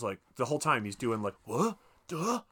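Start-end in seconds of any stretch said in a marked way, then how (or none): 1.4-2.4 higher-pitched, questioning dopey noises; dumbfounded. Retold or imitated. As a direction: imitate short, baffled grunts.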